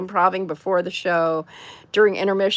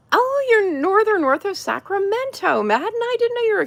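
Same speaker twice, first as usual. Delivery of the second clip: much higher, delighted surprise; higher surprised reply to a viewer; 12 semitones higher than usual. Real, not acted. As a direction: react with glad surprise. Reacting to chat comment.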